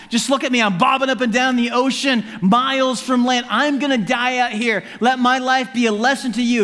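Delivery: high, insistent voice of a doomed man; Jonah lamenting. Acted. Drowning runaway warning those who would flee God, lamenting. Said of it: "he would have said".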